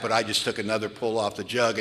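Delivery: ordinary talk, nothing marked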